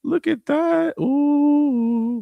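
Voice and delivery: high, loud impressed ooh; impressed viewer. Retold or imitated. Imagined viewer browsing the event page, impressed. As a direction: act out being pleasantly impressed. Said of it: "You're gonna be like".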